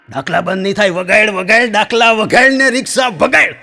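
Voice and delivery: high loud defiant trance order; hammed-up possessed woman refusing; possessed command. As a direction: defy the constable in a trance. Possessed woman defies policeman.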